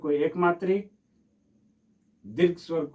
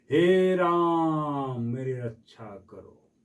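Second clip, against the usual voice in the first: slow calling out; much slower than usual. Shown as a demonstration. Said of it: "बुला रहे हैं ना".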